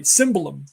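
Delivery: TSIM-ba-lum for "Cymbalum", falling at the end; careful corrected pronunciation. Shown as a demonstration. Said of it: "I gotta say it right".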